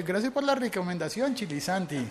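mock thanks with a sarcastic edge. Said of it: "Como siendo sarcástico". (agradecimiento sarcástico imitado, más agudo)

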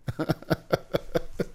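soft unvoiced laugh through frustration; airy helpless chuckle; breathless rueful laugh. Real, not acted. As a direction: laugh it off, barely voiced. Bitter laugh at his loss.

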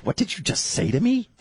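high-pitched mock disbelief; comically incredulous, rising at the end; taken aback. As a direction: retell it as an affronted question. Retold reaction to wife's words.

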